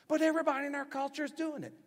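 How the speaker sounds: high, fast, loud pleading; excuse-making churchgoer blurts it high and fast; whiny excuse. Retold or imitated. Imitated excuse.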